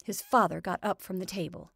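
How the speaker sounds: ordinary delivery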